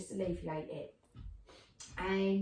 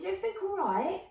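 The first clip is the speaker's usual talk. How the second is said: son checking; 10 semitones higher, 3 dB louder, faster than usual. Retold or imitated.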